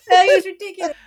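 high, loud outraged protest; indignant. Real, not acted. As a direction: protest the unfair score loudly. Outrage at judge scoring seven.

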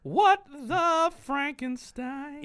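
high, very drawn-out mock exclamation; slow, hammed-up high wail; mock outrage. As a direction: stretch each word in comic disbelief. Mock-acting out WTF from the text.

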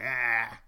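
loud shouted war whoop; a warrior yells his war cry. Retold or imitated. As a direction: let it rip across the water. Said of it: "he let out a war cry".

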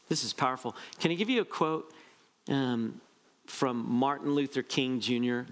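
ordinary talk

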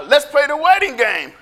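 put-on eager kid's invitation. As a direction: invite friends into a game. Kids proposing a playground game.

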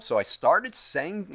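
normal talking voice

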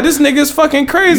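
high, disbelieving exclaim; incredulous. Retold or imitated. Retold reaction to rude stranger.

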